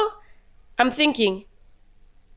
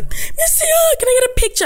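high, eager fan plea, much higher; swarming fans. Retold or imitated. Fans swarming her for a picture. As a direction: beg for a picture, starstruck. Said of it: "a whole group came swarming".

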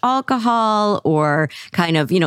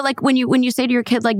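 ordinary talk, nothing marked

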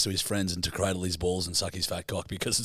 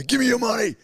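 higher, louder fake hold-up demand; mock robber threat; 12 semitones higher, 5 dB louder than usual. Joking mugger repeats the demand to a scared friend, hammed-up. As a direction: play the menacing masked robber. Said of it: "And I go".

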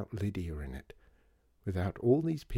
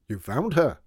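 out of breath; 4 semitones higher, 8 dB louder than usual. Acted. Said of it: "sounding out of breath".

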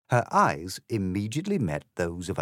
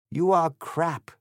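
slow, higher-pitched, louder accusation; anguished; 9 semitones higher, 5 dB louder, slower than usual. Acted. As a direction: draw it out in acted anguish. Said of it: "said the M P with anguish sincere".

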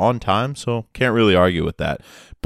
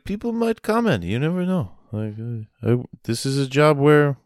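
high, anxious Lyft driver voice; the Lyft driver fretting over ratings, much higher; mock-nervous driver, lifted pitch; worried Lyft driver; 5 semitones higher than usual. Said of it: "he was like".

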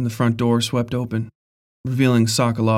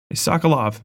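calling out; 4 semitones higher than usual. Acted. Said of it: "I called to the empty porch".

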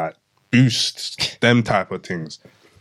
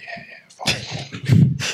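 whispered; much slower than usual. Retold or imitated. Voicing the shop guy.

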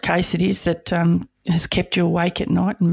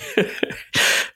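very high airy giggle; breathy giggle. Real, not acted. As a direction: giggle in disbelief. Laughing at being consoled.

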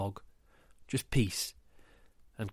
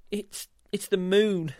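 nervous stammer; 7 semitones higher than usual. Acted.

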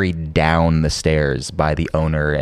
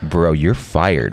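deadpan; much slower than usual. Retold or imitated. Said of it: "He's just like".

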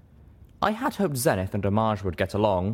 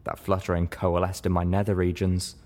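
ordinary delivery

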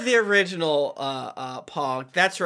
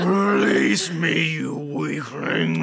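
villain voice; 3 semitones lower, much slower than usual. Acted. Quoting a villain line.

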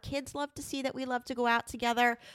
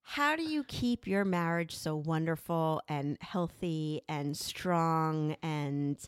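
slow, lowered, hammed-up admiration; theatrical gushing; 6 semitones lower, slower than usual. Admirers ask couple how marriage stays wonderful, theatrical. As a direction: draw out each compliment theatrically. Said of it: "I love this, the theatrics going on".

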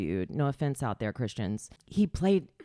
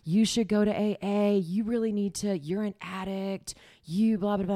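mocking lecture; 7 semitones higher, 4 dB louder than usual. Mocking AA advisers.

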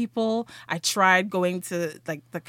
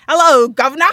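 loud, higher Cockney greeting; Cockney accent; 5 semitones higher, 13 dB louder, faster than usual. Retold or imitated. Waitress greeting as she walks on stage, Cockney accent. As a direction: call it out in hammed-up Cockney. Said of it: "like, Cockney accent".

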